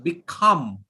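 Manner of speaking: "Become" with the weight on COME, light be-; stress on second syllable. Shown as a demonstration. Teacher models "Become" for the class, stress on COME. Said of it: "put pressure on the second sound".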